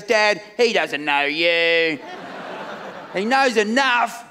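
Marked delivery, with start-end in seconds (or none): 0.0-2.0 slow, low, deadpan voicing of a kid; deadpan daughter. Daughter telling dad the fan doesn't know him, slow and deadpan. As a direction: drag it out flatly like an unimpressed ten-year-old. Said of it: "She goes".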